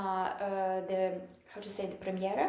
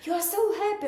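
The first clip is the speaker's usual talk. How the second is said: husband teasing; 10 semitones higher, 5 dB louder, much faster than usual. Retold or imitated. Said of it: "And he was like".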